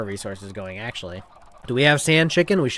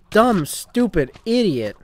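slow furious insult; 8 semitones higher, 5 dB louder, slower than usual. Real, not acted. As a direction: drag out each insult, loud and high. Still raging at the mobs.

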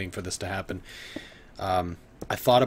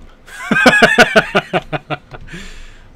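loud laugh; 14 dB louder than usual. Real, not acted. Drunk streamer bursts out laughing.

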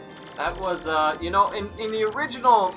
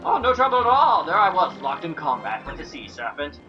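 affected fluting fop. Acted. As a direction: brag like a vain dandy. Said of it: "Flavio's voice".

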